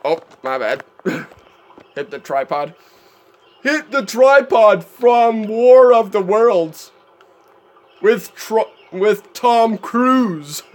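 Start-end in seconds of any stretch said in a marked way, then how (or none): 3.6-10.6 high, loud, drawn-out movie-title delivery; mock-dramatic announcement. Player joking about a film after bumping his tripod, mock-dramatic. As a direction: announce it grandly, stretching the words.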